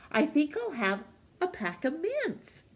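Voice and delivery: cheerful Hatch. Acted.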